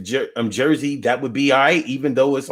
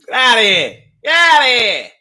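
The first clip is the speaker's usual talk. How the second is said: mock outrage; 9 semitones higher, 6 dB louder than usual. Real, not acted. Mock-outraged reaction to sneaker comment.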